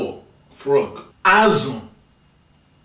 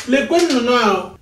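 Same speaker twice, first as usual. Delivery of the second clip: high, louder eager call; excited pointing; 7 semitones higher, 4 dB louder than usual. Shown as a demonstration. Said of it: "you say something like this".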